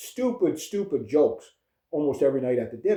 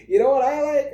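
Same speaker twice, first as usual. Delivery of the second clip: put-on little girl voice; 12 semitones higher, 5 dB louder than usual. Little cousin Chloe quoted at Thanksgiving.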